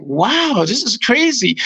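high, swooping mock amazement; retold amazement. Co-stars watching the new scene, high amazed imitation.

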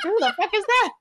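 high-pitched mock bewilderment; baffled outrage. Customer asked for the British girl, mock-indignant bafflement. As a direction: imitate someone thrown by a stranger's name.

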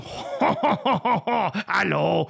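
honking, hammed-up French hello; ridiculous mock French accent, honked high; exaggerated French accent. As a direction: do an absurdly bad French accent. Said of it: "that's a good French accent".

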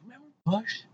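high, soft fond recall; mock nostalgia. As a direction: say it high and small, as if fondly remembering. Wistful nostalgia bit for Bush.

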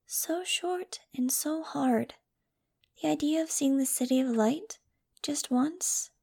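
high, whispery, plaintive plea; sad. A narrator's reading. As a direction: start slow and airy, pleading wistfully. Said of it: "Angel said sadly".

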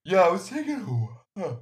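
hammed-up yawn while talking; mock yawning. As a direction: imitate talking through a big, high yawn. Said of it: "can someone please yawn into the mic".

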